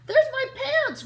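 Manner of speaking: high, loud dismayed cry; P J spotting his pants in the tree, dismayed; groaned. A narrator's reading. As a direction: wail at the sight of his pants. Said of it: "groaned P J".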